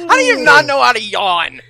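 high, loud, laughing question; laughing disbelief. Real, not acted. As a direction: crack up as you ask.

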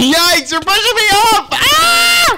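high panicked yelling; yelping as you get pushed; panicked shout. Real, not acted. Losing the fight.

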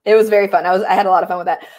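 ordinary delivery

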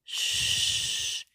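very slow, breathy hush; shush, then whisper it slowly into the mic; ASMR whisper. Pretend. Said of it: "It's A S M R".

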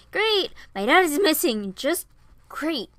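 high, loud outburst; exasperated sarcasm. A narrator's reading. Piper searches the house and finds dad gone, exasperated. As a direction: throw it out in exasperated bursts.

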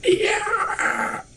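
high strained "yeah" scream; attempted screamed yeah. Player imitating CSI Miami intro scream, strained yeah. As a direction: belt a high yell until it cracks into a cough. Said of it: "Tried to go with that yeah".

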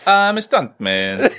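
exaggerated, high-pitched bad Spanish accent; botched Spanish accent. Shown as a demonstration. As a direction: drag out a Spanish accent that slips towards Viking.

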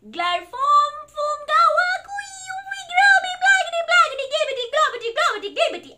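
high, loud sing-song gibberish; silly sounds. Acted. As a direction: rattle off nonsense noises at full tilt. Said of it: "make silly sounds".

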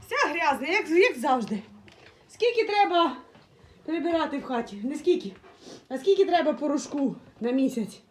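loud, declaiming complaint; loud grumbling. Real, not acted. Owner lamenting dirty house and daily washing powder, loud grumbling. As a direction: complain at full volume about endless housework.

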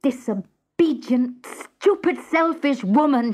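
husband shouting insults, pitch climbing; raging husband. Acted. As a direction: rage at your wife. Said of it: "he raged".

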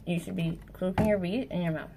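usual speaking voice

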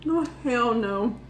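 ordinary delivery

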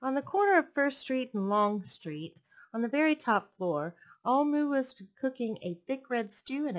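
lively storybook read-aloud; picture book read to a class; reading to children. A narrator's reading. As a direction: read it warmly to little listeners.

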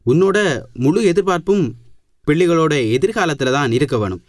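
firm loud voice. Acted. Boss answers loudly.

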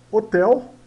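"hotel" stressed high and loud; teacher modelling "hotel"; pronunciation demo. Shown as a demonstration. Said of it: "esse H aqui na frente ... tem o som do R em português".

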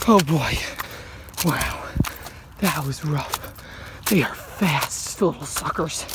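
barely getting the words out after the run; out of breath. Real, not acted. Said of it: "I am way out of breath".

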